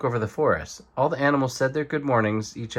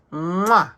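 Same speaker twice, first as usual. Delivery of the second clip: high, louder smacking mwah; loud kiss sound; 7 semitones higher, 5 dB louder than usual. Acted. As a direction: plant a big playful kiss. Porcupine gives a kiss.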